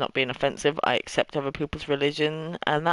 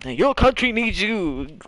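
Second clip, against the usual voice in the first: wartime recruiting poster; 5 semitones higher, 3 dB louder, slower than usual. Retold or imitated. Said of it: "And I sound like".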